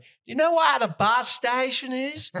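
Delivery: high, slow Tennessee country twang for "Do you know where the bus station is?"; Southern country accent. Retold or imitated. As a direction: imitate a West Tennessee woman asking directions. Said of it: "She went".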